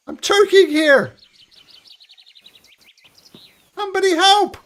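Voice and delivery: high, loud desperate plea; choking panic. Acted. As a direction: scream for help while choking. Trapped NPC choking in gas.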